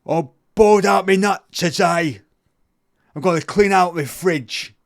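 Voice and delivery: loud, high put-on English accent, slowed; Craig's inner voice in a broad English accent; shouty mock English accent. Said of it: "Craig in his mind was speaking to him like this".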